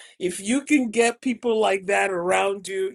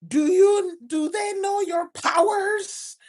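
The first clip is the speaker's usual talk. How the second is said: fervent mentor; 8 semitones higher than usual. Retold or imitated. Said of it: "he will go on and on".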